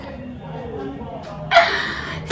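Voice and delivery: big high burst of laughter. Real, not acted. Loud laugh after story.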